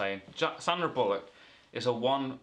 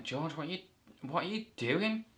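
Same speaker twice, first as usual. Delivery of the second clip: shocked question; 7 semitones higher than usual. Retold or imitated. Sandra confronts returned George.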